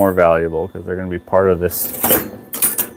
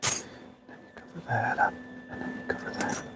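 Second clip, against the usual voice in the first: whispered singsong; 21 dB quieter than usual. Acted. Muttering the line again while gluing cutlery, whispered. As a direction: breathe the words out like a stuck tune.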